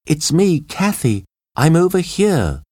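high-pitched call from a distance; high female character voice; woman calling back. A narrator's reading. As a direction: call high so they can find you. Trapped Kathy calls to John.

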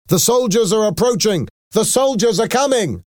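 very high-pitched urgent cries; shouted alarm. A narrator's reading. Rebels warn each other of soldiers, shouting. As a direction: call out a warning at the top of the range. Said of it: "The rebels started to shout".

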